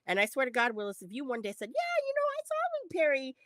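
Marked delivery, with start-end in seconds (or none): 1.7-3.4 high-pitched mock voice of someone casually bragging; mocking imitation. Mimics a bragging acquaintance.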